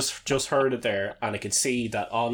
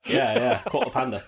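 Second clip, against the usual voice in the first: louder Cockney "caught a panda"; Cockney accent; 3 semitones higher, 6 dB louder than usual. Shown as a demonstration. Showing cohost Cockney, louder accent. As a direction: belt it out Cockney. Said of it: "It's like Cockney".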